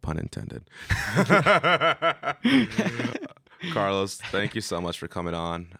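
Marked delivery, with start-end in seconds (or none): 0.7-3.3 loud high burst of laughter; cracking up at his own pun; big laugh. Real, not acted. Host laughing at his own pun, loud burst of laughter.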